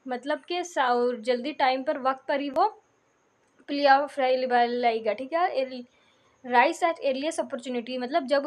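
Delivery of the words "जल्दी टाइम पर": normal talking voice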